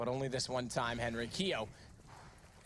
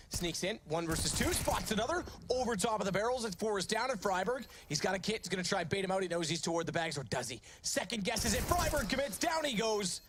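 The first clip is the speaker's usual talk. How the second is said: hyped, much higher play-by-play call; esports caster; 9 semitones higher, 3 dB louder than usual. Real, not acted. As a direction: call the clutch louder and higher, racing. Clutch round play-by-play.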